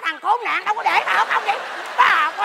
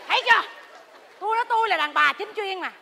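normal talking voice

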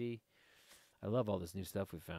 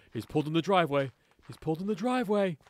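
loud, higher voice of an overjoyed dog; excited puppy; 5 semitones higher, 9 dB louder, faster than usual. Acted. Puppy sees owner pull in the driveway, loud excited voice. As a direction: yelp it out like a dog whose owner is home. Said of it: "He's all excited".

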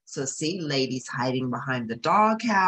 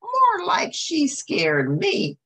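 frowning Tramp; 6 dB louder, slower than usual. Acted. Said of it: "Tramp frowned".

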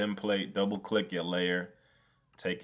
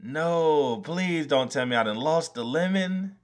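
loud, high pleading wail; loud dismay; 8 semitones higher, 6 dB louder than usual. Real, not acted. Streamer begging his computer for the lost lemon, loud high wail. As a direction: beg the computer not to have lost it.

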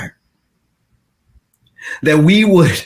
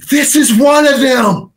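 accusing shout; 6 semitones higher than usual. Acted. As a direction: call him out to the crowd. Servant girl accuses Peter.

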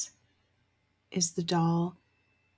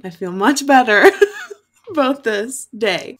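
laughing; 4 semitones higher, 7 dB louder than usual. Real, not acted. Laughing while saying she feels better.

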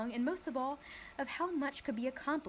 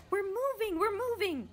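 much higher, louder delighted cry; happy shout; 11 semitones higher, 4 dB louder than usual. Acted. Milo as the car starts rolling, happy shout. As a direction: cheer with delight. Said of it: "he shouted happily".

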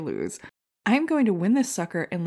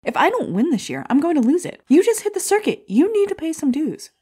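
rising, much higher and louder furious rant; snapping at the newcomer to pay her dues, louder and louder; angry outburst; 11 semitones higher, 7 dB louder than usual. Acted. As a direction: build from threat to full shout at the rookie.

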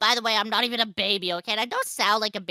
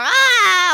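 loud, high yell; startled scream; 9 semitones higher, 10 dB louder than usual. Real, not acted. Player nearly falling off a ledge, startled scream. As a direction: scream out as if slipping.

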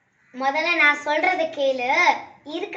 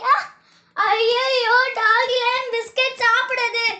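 higher, louder, frantic cries; panicked alarm; 7 semitones higher, 5 dB louder than usual. Acted. Sister panicking as daddy eats all the biscuits, frantic and high. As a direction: rush to stop them eating.

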